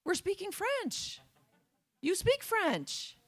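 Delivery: very high, slow, amused disbelief; incredulous French gal. Retold or imitated. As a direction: spell it out slowly, as if it's obvious. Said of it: "she said".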